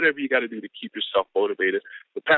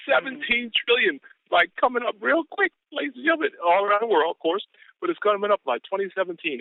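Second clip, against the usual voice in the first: raised, pumped-up pitch all through; hype sales preaching; 8 semitones higher than usual. Real, not acted. Business partner firing up the team, high-energy preaching. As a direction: preach the opportunity with rising fervor.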